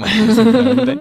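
laughing. Real, not acted. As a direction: blurt it through a laugh. Laughing admission, loud and high.

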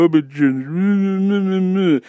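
slow, dragged-out mockery of a whiny guy; mocking dumb voice. Mocking the Zoom guy's reply in a dumb drawn-out voice. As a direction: stretch it out like a dopey crybaby. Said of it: "and this guy go".

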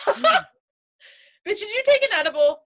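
high, fast, amused disbelief; surprised laugh. Real, not acted. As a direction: blurt it out, half laughing. Teasing friend about edible.

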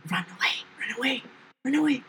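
rapid unvoiced whisper; whispered under breath. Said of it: "Under my breath".

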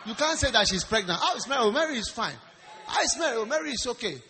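loud, high-pitched preaching; loud sermon storytelling over laughter; animated preaching. Acted. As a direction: preach it out with rising exasperation. Preaches at full force.

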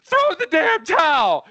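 high, slow shouted plea; yelling. Retold or imitated. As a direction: shout it from ringside. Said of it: "Apollo's manager's on the side going".

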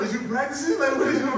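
very high, loud, laughing; laughing tease. Retold or imitated. Dad laughing at his son reciting names. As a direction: squeal the question laughing. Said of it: "I be like".

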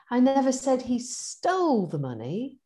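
stole rising, stressed. Shown as a demonstration. Coaching demo of one sentence, stress on stole. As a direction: lean on the word stole. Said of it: "them teaching us this on the coaching course".

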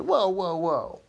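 high, loud alarmed whoa; Mac, suddenly accused by Ollie, in a high, loud alarmed protest; startled whoa. Acted. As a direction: throw up your hands and back off.

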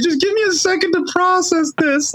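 high, strained plea to mom; overwhelmed plea. Retold or imitated. Runner after finishing telling his mom on FaceTime, strained high plea. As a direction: beg for a minute, choked up. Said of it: "I was just like".

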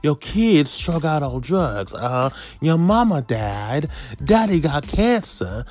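milk the bad news like a Sunday preacher. Imitating a preacher listing the congregation's troubles, slow sermon cadence. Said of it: "let me get my preacher voice now".

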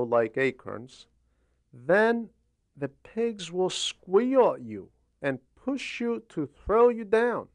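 ordinary talk, nothing marked

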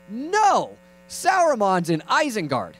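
high drawn-out no, then slow insistence; mock frustrated uncle; exasperated correction. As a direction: correct a child with strained patience. Uncle corrects nephew again.

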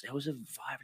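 dropped to a sly hush; hushed mock excuse. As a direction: murmur the excuse under your breath. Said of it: "and it's like".

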